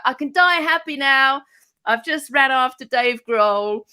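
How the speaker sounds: high, loud ecstatic fan; blissful fan sprawled on the floor; overjoyed gushing. Retold or imitated. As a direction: gush like you just met your hero.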